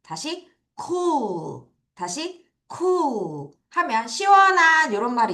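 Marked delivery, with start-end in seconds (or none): 0.8-1.7 "cool" held on a long oo. Shown as a demonstration. Teacher repeating for the class, "cool" with a stretched oo. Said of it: "길게 우 발음이에요". 2.7-3.5 "cool" with the oo lengthened; long OO. Shown as a demonstration. Teacher drilling once more, "cool" with a long oo. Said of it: "길게 우 발음이에요".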